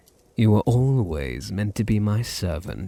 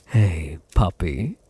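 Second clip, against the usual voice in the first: normal talking voice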